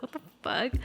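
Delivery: muttered under her breath; quiet. Retold or imitated. Just woke up, confused.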